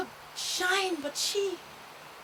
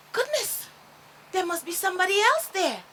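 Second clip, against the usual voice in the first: surprised; 4 semitones higher, 7 dB louder than usual. Acted. Starchild hears laughing far off.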